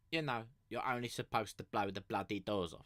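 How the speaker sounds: light cockney Michael Caine quote; a hint of Michael Caine, gruff and London; Michael Caine impression. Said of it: "sort of like the Michael Caine".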